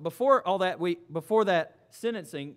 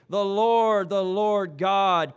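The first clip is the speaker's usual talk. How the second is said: slow and louder, each word weighed; solemn proclamation; 7 dB louder, much slower than usual. A narrator's reading. Reading God proclaiming his name to Moses, slow and loud. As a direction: proclaim it like a herald before the people. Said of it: "and proclaimed".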